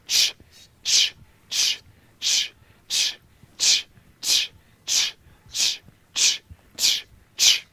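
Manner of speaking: quick unvoiced ch puffs; short ch bursts. Shown as a demonstration. Coach demonstrating to the class, sharp ch puffs. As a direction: pluck popcorn pellets on a ch. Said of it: "and it looks like this".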